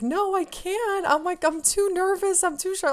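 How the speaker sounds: high, whiny mock-panicked refusal; nervous protest. Telling Danny she can't say hello, nervous protest. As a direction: plead in a high voice, re-enacting shyness. Re-enacting shy refusal to approach.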